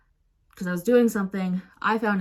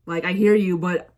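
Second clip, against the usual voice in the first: plain reading voice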